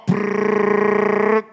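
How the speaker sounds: buzzing mock mower rumble; lawnmower brrr. Pastor mimics a lawnmower for the church, buzzing rumble. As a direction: make the mower noise with your lips.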